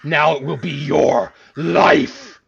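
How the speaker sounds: a wrathful deity declaring doom; booming demon lord. Acted. God attacks the player.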